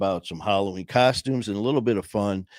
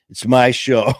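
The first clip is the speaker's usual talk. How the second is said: louder, proud declaration; smug playful boast; mock triumphant; 3 semitones higher, 8 dB louder than usual. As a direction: claim it like a spoiled kid. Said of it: "and actually say".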